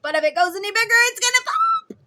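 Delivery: high, loud panicky warning; worried parent. Retold or imitated. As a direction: squeal it as if about to burst. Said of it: "you're like".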